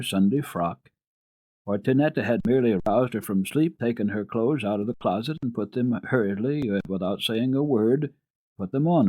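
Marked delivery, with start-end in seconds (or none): none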